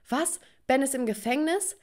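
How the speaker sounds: high, incredulous question; shocked disbelief. Acted. Daughter hears from father that Ben is jailed, shocked. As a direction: jump up in pitch as if stunned.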